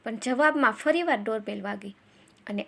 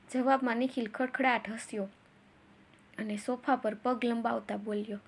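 usual speaking voice

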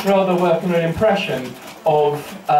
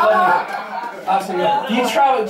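ordinary talk

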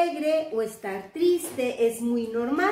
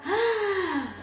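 a high surprised ah; surprised gasp; 5 semitones higher than usual. Shown as a demonstration. Teacher showing kids a surprised face, high gasp. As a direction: gasp as if caught off guard.

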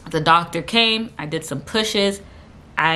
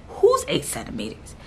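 lower-pitched, deadpan retold question; low disbelief; 4 semitones lower, 4 dB quieter than usual. Patient questioning husband after the check, low disbelief. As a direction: ask it low, as if it can't be you.

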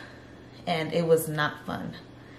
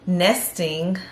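usual speaking voice

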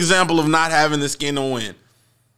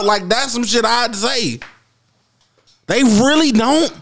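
ordinary talk, nothing marked

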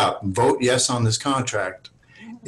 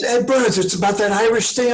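much higher, offhand buddy voice; Ed Burns again; 10 semitones higher than usual. Retold or imitated. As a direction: remind a confused friend of the film pitch.

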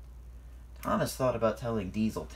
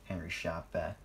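ordinary delivery

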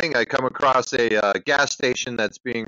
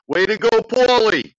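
high, loud East Coast cheer; East Coast accent; 10 semitones higher, 6 dB louder than usual. Acted. Caller signing off to Paulie, East Coast accent. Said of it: "That's an East Coast accent for ya".

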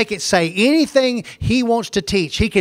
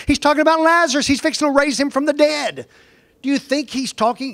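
high-pitched, fast, exasperated correction; incredulous rebuttal; 6 semitones higher than usual. Real, not acted. Preacher correcting a misread Lazarus passage, exasperated. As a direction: hurl the correction out in a raised voice.

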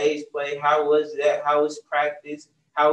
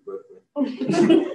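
laughing at Brooklyn; 11 semitones higher, 3 dB louder than usual. Real, not acted. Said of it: "It's not named after you".

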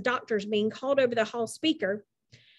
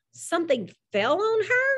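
higher, rising shocked question; stunned echo; 7 semitones higher than usual. A narrator's reading. Wally echoing the nurse's news, stunned. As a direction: repeat it back in disbelief.

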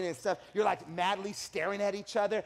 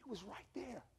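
unvoiced intimate whisper; 17 dB quieter than usual. Real, not acted. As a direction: whisper it like a secret. Whispered point.

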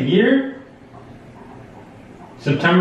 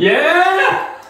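high, excited whoop; cheering yell; 12 semitones higher than usual. Real, not acted. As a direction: shout it in triumph. Celebrating right answer.

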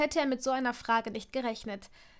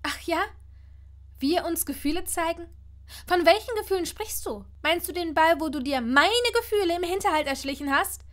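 louder, higher, indignant; angry challenge; 6 semitones higher, 6 dB louder than usual. A narrator's reading. Hermine confronts him about hidden feelings, angry and louder. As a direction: throw the questions back at him in anger. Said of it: "Sie blickte ihm wütend".

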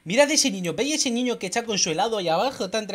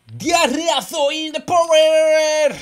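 stretched, louder hype shout; slow, booming attack cry; drawn-out battle cry; 3 semitones higher, 5 dB louder, much slower than usual. Real, not acted. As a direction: call the attack out long and proud. Gamer yells his special attack.

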